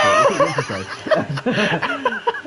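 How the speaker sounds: high, slow, laughing through words. Real, not acted. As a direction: giggle while teasing. Teasing co-host, laughs through it.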